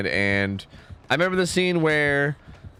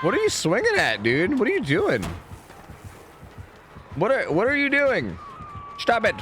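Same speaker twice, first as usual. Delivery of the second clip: squeaky exasperated nagging; 9 semitones higher than usual. Acted. Scolding a teammate again.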